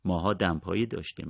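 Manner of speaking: ordinary delivery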